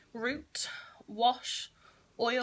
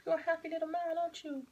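high doting coo; fond pet talk; 7 semitones higher than usual. Real, not acted. Owner fusses her happy cat, fond high pet talk. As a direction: dote on a contented cat in a raised pitch. Cooing at settled purring cat.

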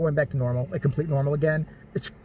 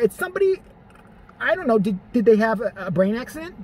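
ordinary delivery

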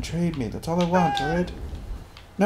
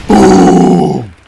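explosive shout; 13 dB louder than usual. Real, not acted. Explosion goes off.

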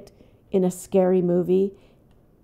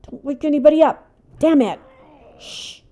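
loud, higher, cursing at the teammate; yelling at a noisy companion; angry scolding; 6 semitones higher, 6 dB louder than usual. Real, not acted. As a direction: snap at Ted to shut up.

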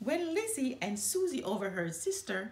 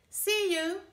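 cheery goodbye; 10 semitones higher, 5 dB louder than usual. Acted. Sister calls goodbye leaving.

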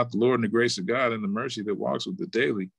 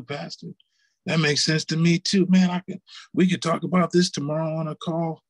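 higher-pitched voice of dawning realization; mildly excited, pitched-up testimony to the pastor; imitated eager congregant; 6 semitones higher than usual. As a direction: lightly act out a listener who has been moved. Acting out congregant reacting to pastor.

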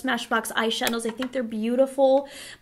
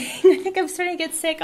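laughing; 5 semitones higher, 4 dB louder than usual. Real, not acted. Laughing at herself being sick.

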